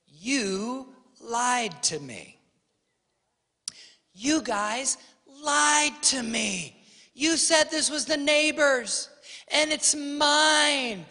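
put-on high, slow wailing kid; crying child. Crying son accusing his parents on Christmas morning, high slow wail. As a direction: wail it like a betrayed little boy. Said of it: "he goes".

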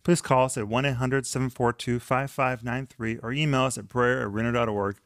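ordinary delivery

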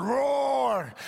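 mock lion roar. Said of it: "a lion got up in the morning and said".

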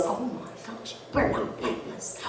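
hushed, mock-shocked whisper; scandalized whisper. Preacher mimicking a religious spirit shocked at being blameless, in a whisper. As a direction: whisper it as if the claim were blasphemy. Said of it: "every religious spirit goes".

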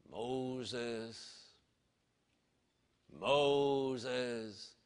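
low, soft, otherworldly call; voice from the bush. Acted. God calling Moses from the burning bush, low and hushed. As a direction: call his name slowly from far off. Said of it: "and a voice said to him".

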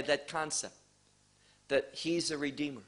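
ordinary delivery

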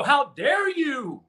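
slow, high mock outrage; mock indignation. Judgmental people pointing fingers at addicts, slow high mock outrage. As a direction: drag it out like a scandalised hypocrite. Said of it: "point their finger and go".

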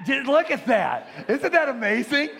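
gleeful teasing with laughter; laughing. Shown as a demonstration. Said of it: "I wanted to illustrate".

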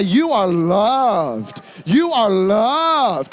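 放慢语速，每个字都轻柔拉长; 像对心爱之人说出真心话; most loving voice. Shown as a demonstration. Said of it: "Say it in your most loving voice".

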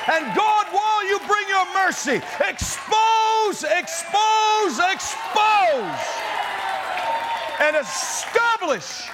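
rally the congregation to fight; thundering sermon build to a shouted peak; fiery preaching. Acted. Preaching at full force.

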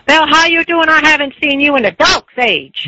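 put-on harsh, high-pitched hearty holler with a Dutch accent; yelling neighbor women. Neighbor woman greeting someone long unseen, sounding like she's yelling. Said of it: "I thought were yelling at me".

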